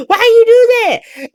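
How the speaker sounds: high, crying mock wail; freaking out. Britt panicking after the shooting, high mock wail. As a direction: wail it out half-laughing, distraught. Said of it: "Britt started freaking out".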